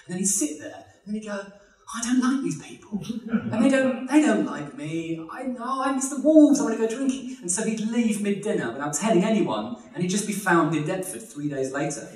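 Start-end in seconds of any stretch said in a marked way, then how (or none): 2.0-7.4 higher-pitched self-pitying grumble; mock sulking. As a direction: play a misfit whining about dinner guests. Sulking Kean at posh dinner.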